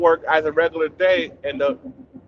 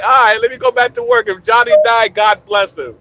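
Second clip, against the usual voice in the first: put-on Jamaican accent; 8 semitones higher, 8 dB louder than usual. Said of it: "The boss like".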